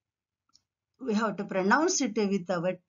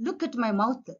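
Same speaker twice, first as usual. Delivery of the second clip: ordinary talk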